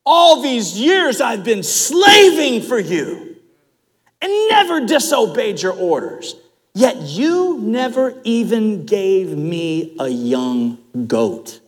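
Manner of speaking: resentful outburst. Acted. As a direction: hurl the years of slaving back at his father. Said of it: "the true heart comes out".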